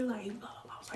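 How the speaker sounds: soft unvoiced blah blah; whispered filler aside. Real, not acted. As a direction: breathe it out under your breath.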